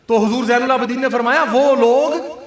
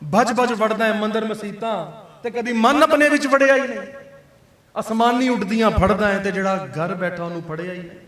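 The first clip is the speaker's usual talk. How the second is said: Sufi verse recitation; 3 semitones lower than usual. Acted. Preacher reciting Bulleh Shah verse to congregation, intoned. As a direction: intone the couplets like a qawwal's verse.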